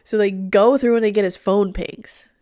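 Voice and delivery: usual speaking voice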